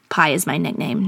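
ordinary delivery

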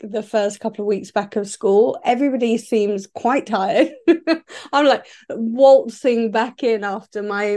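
ordinary talk

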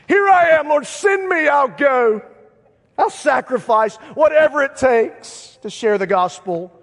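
high, loud, hammed-up volunteering; mock zealous shout. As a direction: cry out with overblown missionary fervor. Mock eager answer to easy calling.

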